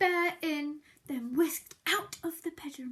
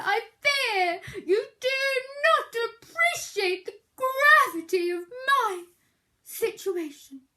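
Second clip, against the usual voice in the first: high, louder moaning sick bear; dramatic whimper; 7 semitones higher, 8 dB louder than usual. Acted. Bear lamenting to Mouse, melodramatic whimper. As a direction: whine it like a dying hero.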